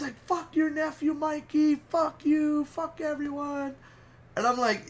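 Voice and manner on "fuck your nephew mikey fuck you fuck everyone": high-pitched tantrum, not shouted; mock petulant cursing. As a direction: imitate a sore loser lashing out, high-voiced. Imitating friend cursing everyone.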